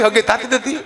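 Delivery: normal talking voice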